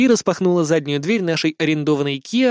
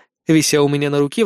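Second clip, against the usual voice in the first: ordinary delivery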